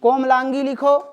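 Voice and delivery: normal talking voice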